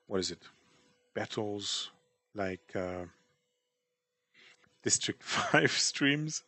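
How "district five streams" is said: laughing through the words, slowly. Real, not acted. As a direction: let the laugh break the name. Laughing naming the show.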